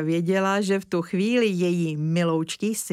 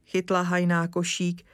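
ordinary delivery

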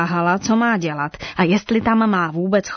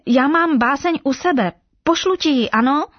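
1 eager calling; 8 semitones higher than usual. A narrator's reading. Giraffe calling to the parrot.

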